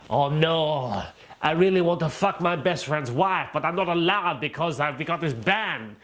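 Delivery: lightly put-on French-sounding whine; mock French accent. Imagined Belgian in quarantine moaning about the ban, mock French accent. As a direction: play a sulking Belgian in a hammed-up French accent. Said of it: "I know that sounds French".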